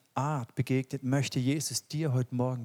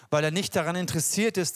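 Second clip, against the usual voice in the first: normal talking voice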